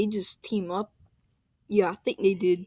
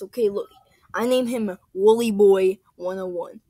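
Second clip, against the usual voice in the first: ordinary talk, nothing marked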